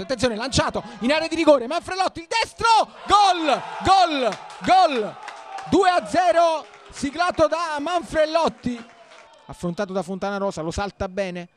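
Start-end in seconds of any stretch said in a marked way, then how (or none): 0.0-8.8 high shouted gol gol gol; breathless italian goal commentary. Real, not acted. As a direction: explode on the goal, then hammer the score. Goal scored, repeated gol shouts.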